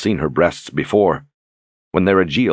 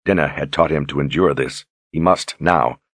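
ordinary delivery

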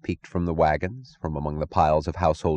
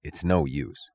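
quiet, lower resigned voice; soft; 4 semitones lower, 5 dB quieter, slower than usual. Acted. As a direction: say it softly, giving up. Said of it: "said the conductor softly".

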